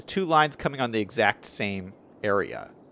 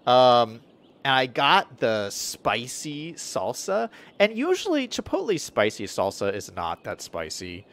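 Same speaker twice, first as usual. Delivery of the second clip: ordinary talk, nothing marked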